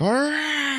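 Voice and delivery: very high strangled cry; hammed-up howl; mock pained scream. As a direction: imitate a tormented scream. Retold man hurting himself cries out.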